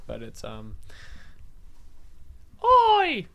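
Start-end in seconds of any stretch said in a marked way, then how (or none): 2.6-3.2 very high, loud mock-Australian oi; shrieked oi as an Australian mom; Australian accent. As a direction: screech a badly faked Australian call. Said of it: "my British accent and my Australian accent".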